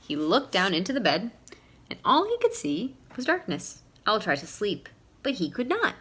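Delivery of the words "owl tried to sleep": plain reading voice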